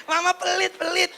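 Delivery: put-on high-pitched childish whine; sulking child. Child accuses mother of being stingy, mock sulky whine. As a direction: complain like a pouting kid. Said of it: "anak itu akan ngambek".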